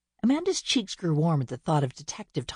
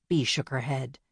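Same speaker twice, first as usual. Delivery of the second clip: usual speaking voice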